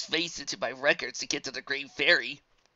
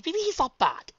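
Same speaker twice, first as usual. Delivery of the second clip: ordinary delivery, nothing marked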